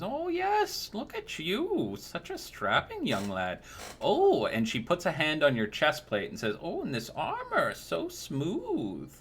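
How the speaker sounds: put-on high, sweet old woman voice, fawning; a doting old crone cooing over a young knight; sugary old lady flattering the customer; old crone baker.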